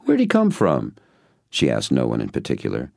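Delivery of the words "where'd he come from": high amused woman voice; woman's voice. A narrator's reading. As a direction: toss it out to the room. Female character voice.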